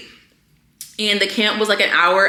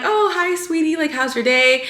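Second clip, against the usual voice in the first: sweet greeting; 6 semitones higher than usual. Retold or imitated. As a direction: imitate greeting a little child sweetly. Greeting a young patient, retold.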